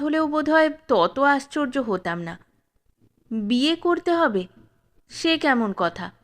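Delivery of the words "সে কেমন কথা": usual speaking voice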